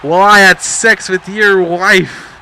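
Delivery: high, loud, drawn-out mock shout; George. George resorts to his last comeback, loudly shouted. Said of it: "has to resort to the last matter".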